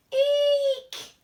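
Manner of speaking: high, loud little shriek; a sudden frightened eek; squeak of fright. Acted. Teacher reading the story, squealing in fright.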